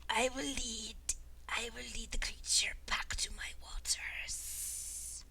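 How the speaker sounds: high whispered creature voice; eerie whisper. Acted. El Conquistador speaking.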